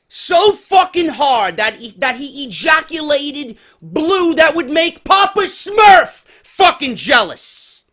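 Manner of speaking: angry yelling rant. Real, not acted. Ranting about internet marks.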